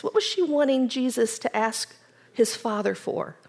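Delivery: ordinary talk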